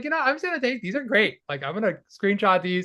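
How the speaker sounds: excited self-quote. Retold or imitated. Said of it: "I was like".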